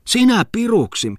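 very high astonished question; incredulous. Acted. Muttinen hears Falk would be Devil, very high disbelief. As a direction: squeal it in disbelief.

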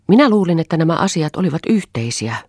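high, loud reproach; agitated appeal, easing slightly; heated protest. Acted. Acted quarrel, wife protests hurt.

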